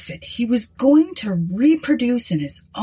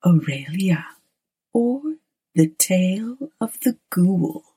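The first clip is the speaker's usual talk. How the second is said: hushed, slow spooky whisper; horror host; slower than usual. Acted. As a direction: whisper like a creepy storyteller host. Horror host introduces the story.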